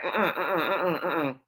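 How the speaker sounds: mock dismissive "uh-uh"s in a quick string; mimicked naysayer butting in; dismissive interrupting. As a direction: imitate someone cutting into a story with repeated refusals. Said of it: "interrupt them while they telling their story".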